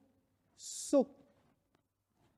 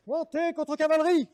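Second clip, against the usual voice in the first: higher, louder barked order; military command; 10 semitones higher, 4 dB louder than usual. Acted. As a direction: bark it like an officer.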